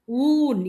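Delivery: ஊன் with ஊ held long and high; ஊன் as a single "oooon"; closing n. Shown as a demonstration. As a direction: draw out ஊ and finish on a plain ன். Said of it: "இன்னு சொல்லணும்".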